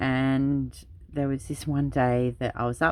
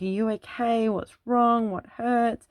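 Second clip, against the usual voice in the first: put-on high, anxious questions to a crying child; worried mum; 9 semitones higher, 3 dB louder than usual. Mum and nana rushing to the fallen child, anxious high-pitched questions. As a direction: imitate mum and nana checking for hurt, pitched up. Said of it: "my mum and my nana are there and they're like".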